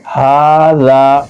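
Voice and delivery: हाज़ा with both aa's held long; slow, steady model reading of हाज़ा; drawn-out long vowels. Shown as a demonstration. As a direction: stretch हा and ज़ा evenly. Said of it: "खींच कर पढ़ेंगे".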